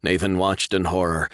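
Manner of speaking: ordinary delivery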